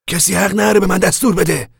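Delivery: loud, high-pitched indignant objection; protesting. A narrator's reading. Al refusing orders from Casey, loud protest. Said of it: "آل اعتراض کنان گفت".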